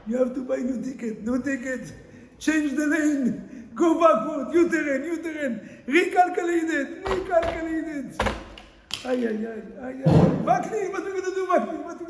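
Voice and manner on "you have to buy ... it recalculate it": commanding GPS voice. Acted.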